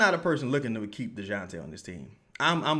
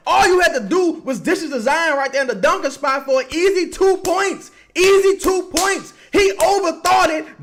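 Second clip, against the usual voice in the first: much higher, louder frustrated rant at the screen; furious yelling; 13 dB louder than usual. Real, not acted. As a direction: scream at the player who blew the easy pass. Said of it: "I apologize for yelling, y'all. My bad for raising my voice".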